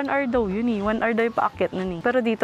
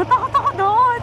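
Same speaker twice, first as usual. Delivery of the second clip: shaken; 13 semitones higher, 4 dB louder than usual. Real, not acted. After scary stretch.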